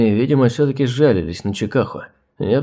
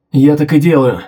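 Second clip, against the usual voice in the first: exhaled shout; 3 semitones higher, 6 dB louder than usual. A narrator's reading. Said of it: "Выдохнул он".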